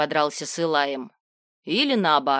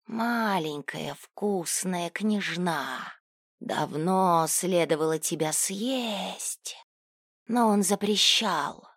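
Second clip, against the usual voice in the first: hissing demon voice; much slower than usual. Acted. Demon threatens the princess.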